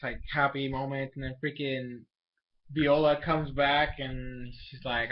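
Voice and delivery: ordinary talk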